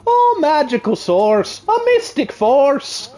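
lightly put-on Irish accent, drawn out. Shown as a demonstration. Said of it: "in an Irish accent".

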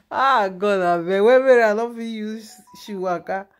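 laughing too hard to talk straight; loud, amused outburst through laughter. Real, not acted. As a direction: burst out laughing mid-story. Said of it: "Laugh just dey kill me".

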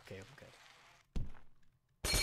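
slow breathy whisper, okay stretched up; whispered reassurance. Pretend. Gamer after a fall, slow whisper. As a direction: whisper it slowly to yourself.

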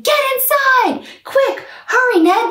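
high loud urgent call; shouted warning. A narrator's reading. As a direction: hurry everyone in, shouting. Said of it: "The knights all shouted".

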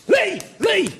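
high-pitched calls through a door; calling a name. Retold or imitated. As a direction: call his name as if banging on the door. Bloke banging on door calling.

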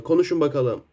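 ordinary talk, nothing marked

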